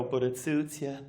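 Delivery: low, soft parting jab; Irish mother voice. Retold or imitated. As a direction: toss the compliment back low over the shoulder. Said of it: "leave the room and go".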